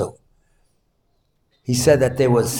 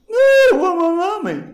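very high-pitched mock blubbering; crying little boy; 4 dB louder than usual. Boy crying at father's house for mommy, imitated high wail. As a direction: imitate a small child wailing for a parent. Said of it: "the little boy starts crying".

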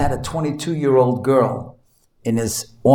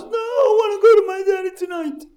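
very high-pitched mock tantrum wail; crying little boy; faster than usual. Boy refusing mother at bedtime, imitated piercing tearful wail. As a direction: imitate a child wailing in stubborn protest. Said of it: "He didn't stop crying".

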